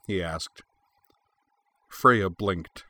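ordinary delivery

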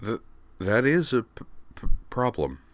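lightly voiced girl who stutters on first sounds; stuttering Kate. A narrator's reading. Roommate Kate answers Utana, stuttering lightly. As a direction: trip gently over the p's.

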